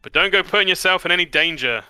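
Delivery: loud, high, quick motherly warning. Acted. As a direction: raise your voice in a brisk parental warning. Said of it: "she raises her voice again and is like".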